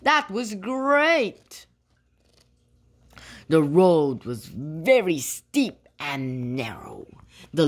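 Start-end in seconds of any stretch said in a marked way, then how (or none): 0.0-1.7 high and drawn out; elated storybook voice; delighted exclamation. A narrator's reading. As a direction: relish it after the thrill. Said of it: "laughed Aisha".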